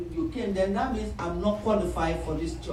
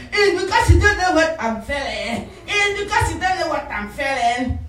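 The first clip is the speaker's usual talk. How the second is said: high, raised-voice complaint; upset protest; 8 semitones higher, 4 dB louder than usual. Acted. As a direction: act out an indignant, self-pitying protest. Acted protest at being comforted.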